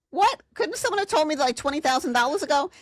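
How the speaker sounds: high-pitched retold outburst of disbelief; mock disbelief. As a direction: exclaim it high, as if cheated. Retold own indignant outburst to teacher.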